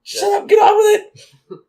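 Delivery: high, loud mock shout; exasperated shout. Real, not acted. Shouting down an imagined rambling storyteller, exasperated.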